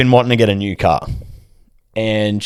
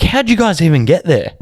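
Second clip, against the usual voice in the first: higher, baffled question; puzzled high uncle, pitched up; imitating a stoned uncle; 6 semitones higher than usual. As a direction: ask it as if you just lost track. Imitates high uncle losing focus.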